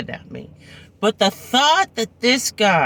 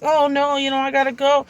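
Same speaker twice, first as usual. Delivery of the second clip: polite excuse; 8 semitones higher than usual. Retold or imitated. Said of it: "I was like".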